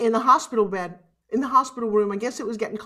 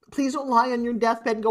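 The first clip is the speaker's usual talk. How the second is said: normal talking voice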